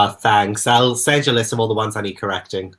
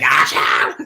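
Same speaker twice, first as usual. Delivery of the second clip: big sneeze. Retold or imitated. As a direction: let the sneeze blast out. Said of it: "And that's me like".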